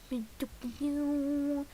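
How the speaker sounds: normal talking voice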